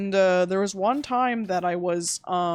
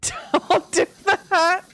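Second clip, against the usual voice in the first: laughing; 11 semitones higher, 6 dB louder, much slower than usual. Real, not acted.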